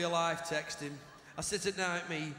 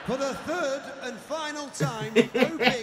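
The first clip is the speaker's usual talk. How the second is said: pushy caller; 4 semitones higher, 6 dB louder, slower than usual. Retold or imitated. Dirty caller repeats, pushier.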